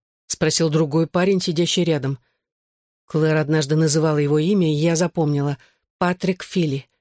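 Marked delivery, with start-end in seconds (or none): none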